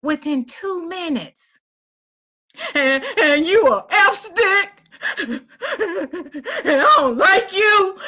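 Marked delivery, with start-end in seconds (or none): 2.7-8.0 high, drawn-out mock sobbing; ex crying. The ex breaking down on the phone, mock crying. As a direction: blubber the words like a wounded man. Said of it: "he started crying".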